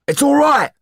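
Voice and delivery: calm her fast, pressing; urgently. Acted. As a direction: Didier urgently reassuring Sido as she resists. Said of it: "he said urgently".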